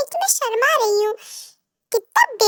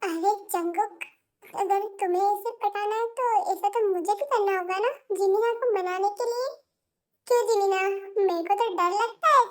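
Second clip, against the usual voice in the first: hushed and softer, a murmured side remark; quiet aside; 3 semitones lower, 8 dB quieter than usual. Acted. Boy murmuring aside to friend about wooing Jinia, hushed. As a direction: keep it low so the girls won't hear.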